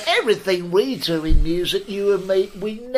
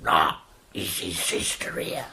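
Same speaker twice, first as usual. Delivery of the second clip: much lower, breathy menacing whisper; whispered sister voice; 12 semitones lower than usual. Retold or imitated. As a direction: hiss it like a sinister conspirator. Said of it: "and I".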